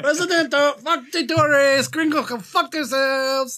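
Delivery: high, slow, hammed-up mock Spanish accent. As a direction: play a tourist faking a Spanish accent to steal a seat. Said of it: "emails about the accents".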